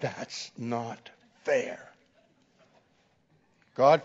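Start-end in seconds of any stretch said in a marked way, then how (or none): 0.0-1.9 slow, softer resentful protest; angry. Retold or imitated. Angry student repeating his complaint to the professor, slow and resentful. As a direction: grind out the complaint, quietly angry. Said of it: "And now he's angry".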